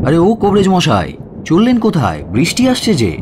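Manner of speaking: high-pitched call to someone hurrying past. Acted. Narrator calling out to the physician in the yard. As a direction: hail him before the rain comes. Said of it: "ডেকে বললাম".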